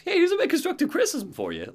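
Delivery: high, quick mock critic; lightly voiced know-it-all. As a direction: chirp the criticism brightly. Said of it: "turns around and goes".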